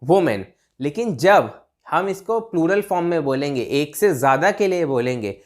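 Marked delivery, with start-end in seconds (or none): 0.0-0.4 वुमेन with "wu" first, falling; singular woman pronunciation. Shown as a demonstration. Teacher repeating the singular form for learners, "wu" pronunciation. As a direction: say वुमेन as "wu-men". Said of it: "जब single औरत होगी".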